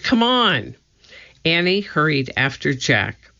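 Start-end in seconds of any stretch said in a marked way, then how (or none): 0.0-0.8 high, urging call; excited, raised-pitch shout-out; crying out. A narrator's reading. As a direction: urge them along eagerly. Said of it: "he cried".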